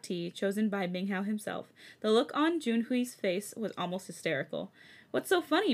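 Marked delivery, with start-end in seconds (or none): 5.1-5.7 high whiny complaint. Acted. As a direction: whine it like a sulky boyfriend. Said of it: "in a whiny voice".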